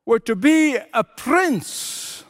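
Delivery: high, slow, stretched-out plea; imitated crying out. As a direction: cry out the wish, drawing each word out. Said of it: "Then he cried out".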